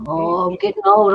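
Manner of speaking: exaggerated grumble about age; mock old man complaint. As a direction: play up being old and deaf. Joking about his poor hearing.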